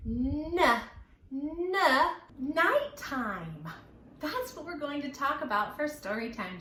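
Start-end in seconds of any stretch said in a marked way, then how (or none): none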